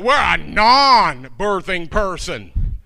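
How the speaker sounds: normal talking voice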